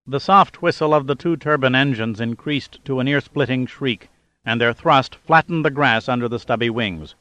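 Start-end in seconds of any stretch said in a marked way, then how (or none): none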